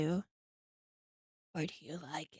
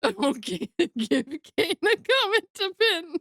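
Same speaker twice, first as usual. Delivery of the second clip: higher, laughing through the line; cracking up mid-sentence; 9 semitones higher, 5 dB louder than usual. Real, not acted. Laughing at own joke.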